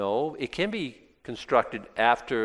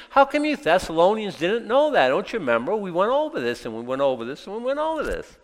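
exasperated teacher; 8 semitones higher than usual. Acted. Paul scolding the Thessalonians.

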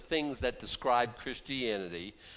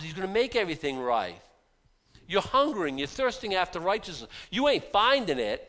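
higher and louder, pressing on; impassioned preaching; 6 semitones higher, 6 dB louder than usual. Acted. Preacher stirring the congregation about the world's evil, impassioned. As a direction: preach it with rising fire.

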